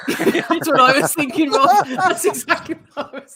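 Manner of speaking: high words broken by laughter; laughing while talking. Real, not acted. Host agreeing with co-host's joke, laughing through it. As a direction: speak through the giggles.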